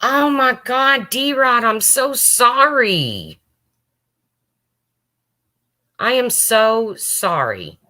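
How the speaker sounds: high, slow, near tears; choking up while apologizing to D-Rod; tearful apology. Real, not acted. Streamer near tears apologizing to grieving viewer D-Rod, high and slow.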